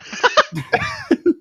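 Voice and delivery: very high burst of laughter; high shrieking laugh. Real, not acted. Laughing at the stubborn refusal, high shriek.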